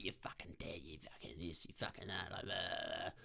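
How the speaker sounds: hushed, whispery imitation of a screamed rant; retold mock scream. Comic imitating a screaming tradie, muted mock rant. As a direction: yell under your breath, low and very soft. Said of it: "he screamed at me like".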